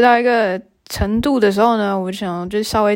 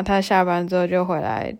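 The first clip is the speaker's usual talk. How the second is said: usual speaking voice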